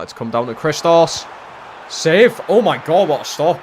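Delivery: rising into a loud, high roar of delight; excited yell. Real, not acted. Gamer yelling at his keeper's last-minute save, loud and high. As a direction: shout it like the keeper just won you the game.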